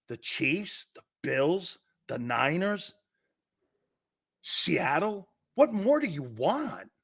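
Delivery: mock excuse. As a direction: play the fan listing wins as excuses. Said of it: "go like this".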